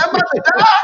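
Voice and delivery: high, loud, laughing; laughing mock panic. Waking to the chaplain, laughing in mock panic. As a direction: squeal it through laughter. Thinking chaplain means death.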